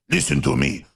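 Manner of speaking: hammed-up Russian accent, word by word; bad Russian accent. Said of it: "I don't really do a good Russian accent".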